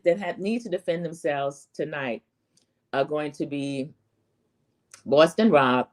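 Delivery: ordinary talk, nothing marked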